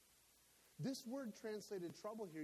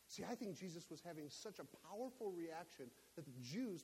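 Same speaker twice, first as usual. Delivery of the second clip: usual speaking voice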